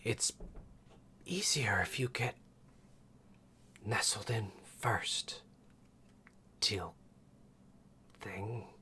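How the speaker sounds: hushed, breathy snake woman; snake mom voice. Acted. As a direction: whisper like a sly serpent lady. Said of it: "Snake Mom's voice".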